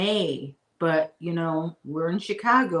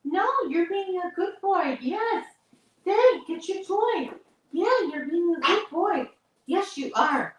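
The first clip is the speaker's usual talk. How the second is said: much higher pet-praising voice; puppy talk; 11 semitones higher than usual. Real, not acted. To her puppy Chester, much higher dog-praise voice. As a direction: coo up high at a good puppy.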